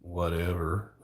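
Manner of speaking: laid-back Southern drawl; lazy cowboy drawl. Shown as a demonstration. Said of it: "that's my lazy cowboy voice".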